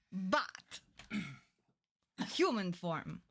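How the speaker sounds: deep, slow Count Dracula voice; Transylvanian vampire accent. Acted. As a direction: greet like an old-world vampire. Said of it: "We're not doing that voice the whole time".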